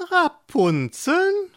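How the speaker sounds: high, drawn-out cackling witch; sly witch. Acted. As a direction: draw the word out like a teasing hag. Witch asks slowly.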